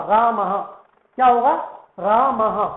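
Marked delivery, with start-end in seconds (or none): 0.1-0.6 "रामः" said raa-ma-ha, lower; careful "रामः" with audible final ha; visarga sounded. Shown as a demonstration. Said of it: "विसर्ग के अनुसार इसका उच्चारण". 2.0-2.7 "रामः" as three clear syllables, raa-ma-ha; visarga sounded. Shown as a demonstration. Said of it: "हम किसको उच्चारित कर रहे".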